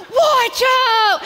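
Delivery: high, loud urgent yell; warning shout. Retold or imitated. Friend warns her of the gangplank, a loud high yell. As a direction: yell a warning before it hits.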